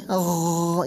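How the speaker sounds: غ as a long low gargled rasp; drawn-out throaty غ; from the throat. Shown as a demonstration. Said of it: "یہ اس طرح سے".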